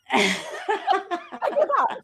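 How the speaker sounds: very slow, high, laughing give-up; laughing surrender. Retold or imitated. Friend texting another friend, laughing mock surrender. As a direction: drag it out through a laugh. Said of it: "I've done it before".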